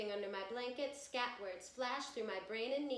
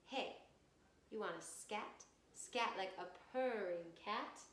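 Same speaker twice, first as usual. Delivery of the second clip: ordinary delivery, nothing marked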